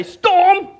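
high, loud command with attitude. Shown as a demonstration. Preacher shows congregation how to address storms, with attitude. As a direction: belt it out with some attitude. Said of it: "say it with a bit of attitude".

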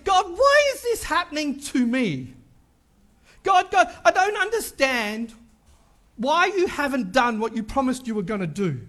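rising, pleading cry to God; desperate pleading prayer. Retold or imitated. Pastor retelling his prayers to God, pleading and near tears. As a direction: beg God for an answer, voice breaking at the end. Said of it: "I've said".

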